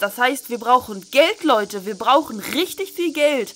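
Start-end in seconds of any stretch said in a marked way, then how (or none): none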